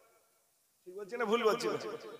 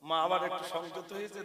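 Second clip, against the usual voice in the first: ordinary delivery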